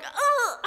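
high, mock-pained groan; acted-out yelp of discomfort; retold "ugh". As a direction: imitate a stung "ugh". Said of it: "and I was like".

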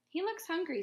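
put-on high, loud girl voice; a child's worried high voice; little girl's voice. A narrator's reading. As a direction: pitch it up like a young girl. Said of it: "said the girl".

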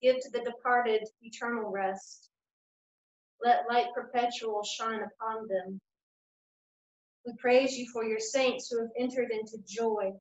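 normal talking voice

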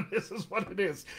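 amused, laughing through the words. Real, not acted. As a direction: let a real laugh spill into the line. Amused at viewer's toothbrush comment.

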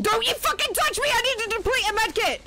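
scared and angry. Real, not acted. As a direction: snap at him, frustrated. Scolding teammate.

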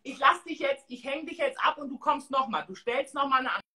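ordinary delivery

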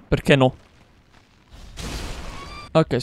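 ordinary delivery

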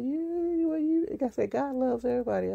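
retelling an eager reply to the youth; excited insisting. Retold or imitated. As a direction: rush it out eagerly.

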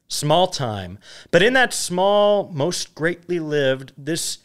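measured, declaimed Shakespearean chorus with swelling opening phrases; dramatic verse reading. Acted. Reader delivering the epilogue as the Chorus, dramatic verse reading. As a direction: declaim the verse grandly, pausing between lines.

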